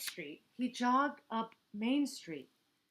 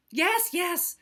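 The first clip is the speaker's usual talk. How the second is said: impatient Hippo, higher and louder; snorting hippo; 10 semitones higher, 9 dB louder than usual. Acted. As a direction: brush it off with a snort. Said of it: "snorted Hippo".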